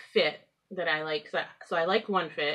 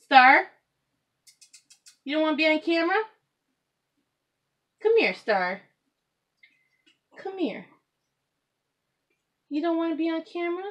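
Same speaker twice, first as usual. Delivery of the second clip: pet talk; 10 semitones higher, 6 dB louder than usual. Real, not acted. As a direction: coax the cat in a much higher voice. Coaxing the cat on camera.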